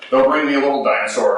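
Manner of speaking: normal talking voice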